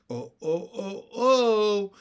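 rising run of loud, high ohs; gasping cry. A narrator's reading. Jane helpless with laughter, gasping cry. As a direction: cry out, overcome, building to the last oh. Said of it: "cried Jane, as she gasped for breath".

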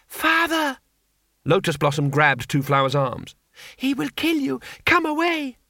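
put-on high, urgent young woman's cry; pleading daughter. A narrator's reading. Lotus Blossom begging her father not to fight, high urgent plea. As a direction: beg him to stop.

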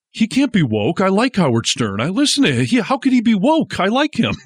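high whiny mock voice; mocking Gutfeld. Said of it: "and he was like".

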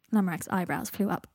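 plain reading voice

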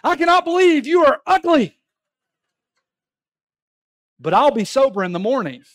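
hammed-up loud high mock retort; a tipsy statesman's loud, high jab; Churchill's comeback. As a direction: throw the insult back in her own shrill voice. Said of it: "And he says back to her".

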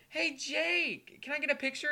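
high, pestering holler; eager fan calling. Retold or imitated. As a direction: call out to a player, pleading for attention. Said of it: "And he's like".

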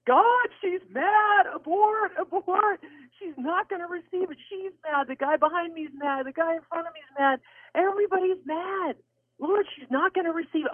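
high, frantic mock panic; panicked protest. Speaker begging the Lord to let her off, panicked. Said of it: "my first response is".

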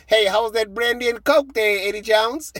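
imitated heckle, high and loud; taunting reporter. Made-up reporter needles the beaten coach, loudly teasing. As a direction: call out a cheeky mock question. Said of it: "I'm just gonna make this up".

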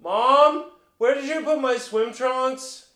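high, slow call across the house; drawn-out projected household call; projecting, not yelling. Shown as a demonstration. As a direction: sing out to mom in another room. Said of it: "projecting than, like, yelling".

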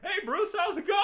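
very high, loud cheery caricature; oblivious chill friend. Acted. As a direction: greet like a clueless, carefree buddy. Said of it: "He's very chill".

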